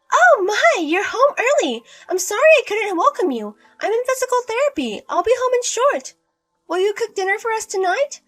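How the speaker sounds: high, loud, sweet-talking mother-in-law; sugary mother-in-law. Acted.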